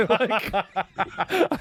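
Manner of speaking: talking through real laughter; laughing. Real, not acted. Retelling the voicemail story, still laughing. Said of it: "I'm still laughing so hard".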